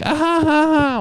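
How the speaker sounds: high, loud mock giggle; imitated giggling. Bartender imitating the ladies laughing at his joke, high mock giggle. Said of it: "like, laughing and shit".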